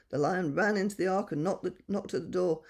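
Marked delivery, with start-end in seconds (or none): none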